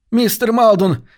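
high-pitched, urgent calling out; excited gabbling. A narrator's reading. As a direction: burst in calling the name, pitch climbing on the repeat. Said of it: "затараторил он". (взволнованно, высоким голосом)

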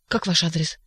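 ordinary delivery, nothing marked

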